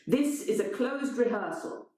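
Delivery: high, firm rebuke; lightly voiced stern director; strict director. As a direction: lay down the rule. Elena scolds intruder.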